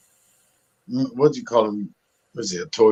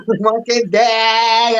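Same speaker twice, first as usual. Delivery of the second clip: exasperated; 12 semitones higher, much slower than usual. Retold or imitated. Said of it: "She goes".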